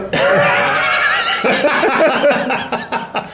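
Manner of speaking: long high-pitched laugh; helpless laughing fit. Real, not acted. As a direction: burst out laughing mid-announcement. Bursts out laughing mid-announcement.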